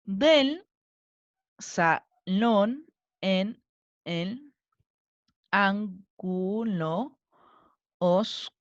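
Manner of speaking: very slow, each syllable on its own. Shown as a demonstration. Teacher separating a verse's syllables for students, slowly chunked. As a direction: break every word into separate, stretched syllables. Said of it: "Separemos las sílabas".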